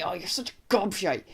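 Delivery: understated put-on annoyance; mock angry. Shown as a demonstration. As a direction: insult someone as if cross, only mildly. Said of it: "don't really get really angry".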